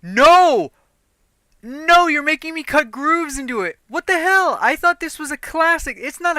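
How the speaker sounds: high, loud cry of dismay; loud dismayed outcry. Real, not acted. Reacting to the cut grooves, a high loud outcry. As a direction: yelp it out as the job goes wrong. Ruining gun while cutting grooves.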